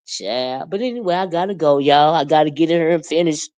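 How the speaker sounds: ordinary delivery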